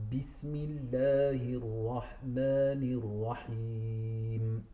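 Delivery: "Rahmanir Rahim" with a breathy throat ha; correct ha. Shown as a demonstration. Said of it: "Then we have".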